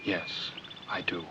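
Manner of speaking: soft, slow, low-key line reading; very quiet. Shown as a demonstration. Director showing actress how to answer the husband, very quietly. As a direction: demonstrate it very quietly, almost under your breath. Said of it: "just say very quietly".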